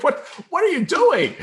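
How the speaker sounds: very high, loud, alarmed cry; startled protest. Retold or imitated. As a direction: cry out in disbelief, voice shooting up. Repeats shocked protest at kiss.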